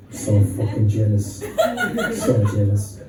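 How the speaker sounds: squeaky mock-jealous giggle; mock jealous whine.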